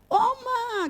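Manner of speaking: high call of Oma; yelling for grandma after a fall down the stairs. Retold or imitated. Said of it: "saya langsung teriak".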